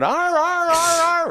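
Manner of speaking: high, strained yelps of a hurt dog; dog convulsing noise. Retold or imitated. Storyteller mimics the kicked dog on the floor, high strained yelps.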